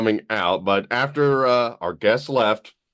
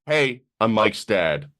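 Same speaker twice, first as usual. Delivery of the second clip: ordinary talk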